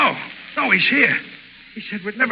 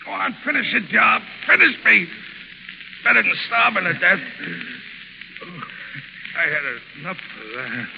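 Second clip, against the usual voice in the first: weak, wounded; 3 semitones lower, 5 dB quieter than usual. Acted. Ward wounded and starving.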